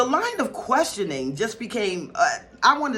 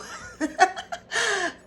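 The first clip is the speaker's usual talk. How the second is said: high giddy laugh; 14 semitones higher than usual. Real, not acted. Giddy laugh at her own excitement.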